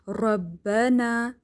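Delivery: ربنا drawn out slowly, ra tapped once; single ra recitation. Shown as a demonstration. Teacher models ربنا with a single ra. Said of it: "بہت زیادہ را پہ اس طرح تکرار نہ آئے".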